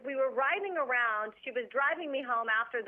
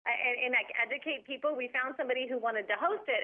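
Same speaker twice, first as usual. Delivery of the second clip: ordinary delivery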